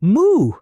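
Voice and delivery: loud high moo. Acted. Cow mooing.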